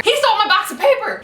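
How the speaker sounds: high, fast accusing shout; retold yell. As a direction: blurt the accusation at the teacher. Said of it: "he heard me yelling".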